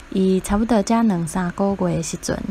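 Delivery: ordinary talk, nothing marked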